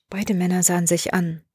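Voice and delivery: usual speaking voice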